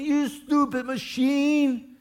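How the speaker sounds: high, slow, loud mock yell at a machine; hammed-up rage at the stupid machine. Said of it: "You start yelling at the".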